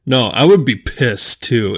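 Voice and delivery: ordinary delivery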